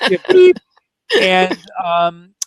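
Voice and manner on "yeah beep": a high, loud bleep sound to cover the swear; mock censor bleep. Echoing the host's bleep to hide a swear, high loud beep.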